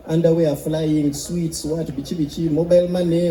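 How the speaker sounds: ordinary talk, nothing marked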